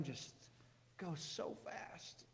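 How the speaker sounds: slow breathy whisper. Real, not acted. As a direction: let it fall to a whisper. Musing on time fleeting.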